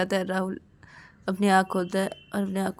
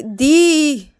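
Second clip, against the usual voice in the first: loud, raised call; 7 semitones higher, 10 dB louder than usual. Acted. Rahul calling his quarrelling sister, loud call. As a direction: call sister over the noise.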